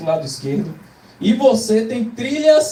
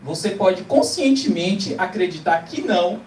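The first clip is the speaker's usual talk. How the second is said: usual speaking voice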